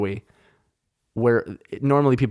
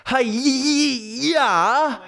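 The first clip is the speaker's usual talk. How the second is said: high, long karate yell; hammed-up martial arts battle cry; mock karate cry; 13 semitones higher than usual.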